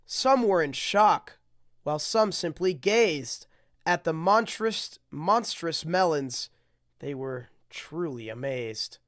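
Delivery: sing-song rhyming read-aloud; storybook reading. Acted. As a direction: read it like a bedtime picture book. Reads rhyming picture book aloud.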